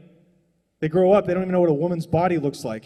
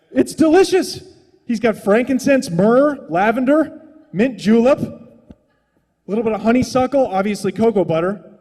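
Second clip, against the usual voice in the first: higher, louder ecstatic listing; gushing rave; 5 semitones higher, 5 dB louder than usual. Real, not acted. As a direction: savour each scent out loud. Raving about a scent.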